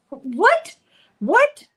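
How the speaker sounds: high, loud disbelieving What; outraged double take, rising high; mock shock at an insult. Shocked at being called stripper.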